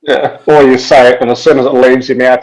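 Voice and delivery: ordinary talk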